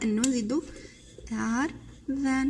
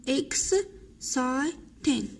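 Exciting stretched out very slowly, syllable by syllable; slow drilled word; much slower than usual. Shown as a demonstration. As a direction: say Exciting slowly for pupils to repeat. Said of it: "slow syllable-by-syllable repeat". (نطق بطيء جدا مقطع بمقطع)

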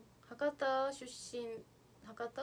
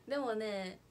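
ordinary talk, nothing marked

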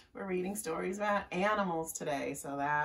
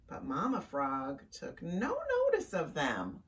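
ordinary delivery

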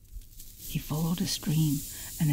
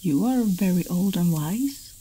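louder, higher, lightly flattering storyteller's line; butter up the listener, a touch emphatic; 4 semitones higher, 6 dB louder than usual. A narrator's reading. Dragon man hoping to flatter an old turtle, mildly ingratiating. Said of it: "hoping to flatter him".

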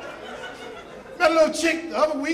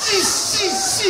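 stuttering; 4 semitones lower, 3 dB quieter, slower than usual. Retold or imitated. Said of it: "She stuttered".

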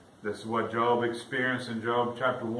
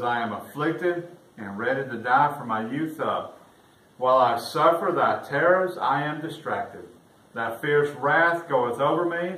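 raised, louder solemn reading of the psalm; preacher reading the King James lament to the congregation, raised and solemn; scripture reading; 2 semitones higher, 5 dB louder than usual. Acted. Reads psalm scripture aloud.

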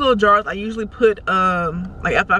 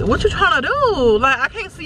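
indignant outcry; 8 semitones higher than usual. Real, not acted. Driver cuts into her lane.

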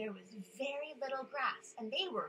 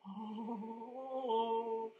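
ordinary delivery

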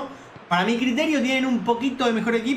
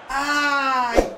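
surprised cry; 5 semitones higher than usual. Real, not acted. Tiro libre a punto de entrar.

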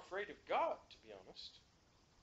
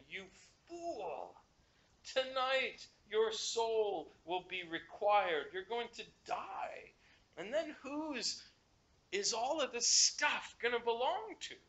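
God rebuking; 6 semitones higher, 5 dB louder, slower than usual. Retold or imitated. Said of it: "But God said to him".

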